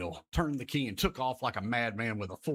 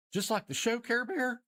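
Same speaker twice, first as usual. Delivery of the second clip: put-on much higher child's voice; kids asking; 12 semitones higher than usual. Kids asking Care Bear, excited child voice. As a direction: ask it like an eager little kid. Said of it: "Kids say".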